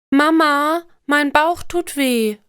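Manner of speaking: put-on high, drawn-out little girl's whine; complaining child. A narrator's reading. Little girl tells her mother of bellyache, plaintively. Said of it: "klagt Alina".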